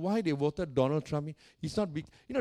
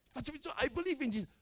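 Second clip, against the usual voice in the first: usual speaking voice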